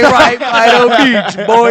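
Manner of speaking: loud, high mock-Jamaican holler; fake Jamaican accent. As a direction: shout it like a phony Jamaican, hammed up. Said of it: "he's using this fake Jamaican accent".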